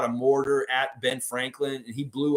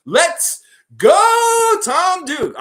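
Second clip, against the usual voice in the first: much higher, much louder cheer; hype shout; 10 dB louder, much slower than usual. Real, not acted. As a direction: fire up the crowd. Hype sign-off call.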